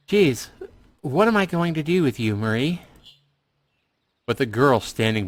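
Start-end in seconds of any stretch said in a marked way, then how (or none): 0.1-2.8 Lyon exasperated. Acted.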